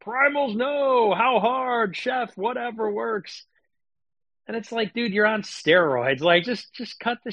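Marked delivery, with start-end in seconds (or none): 0.1-3.4 mock Liver King slogan. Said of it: "What's the stupid slogan?".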